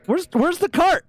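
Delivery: very high shouting where's the cart; frantic high yell. Real, not acted. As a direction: yell it in a panic. Frantic search mid-game.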